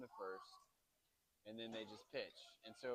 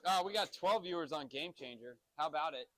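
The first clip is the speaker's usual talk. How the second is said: excited; 3 semitones higher, 7 dB louder than usual. Real, not acted. Cheering new stream viewers.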